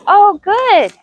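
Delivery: high, pleased little oh good; delighted praise. Real, not acted. Trainer praising mare who came in, delighted. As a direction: praise the horse with sudden delight. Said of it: "praising horse joining up".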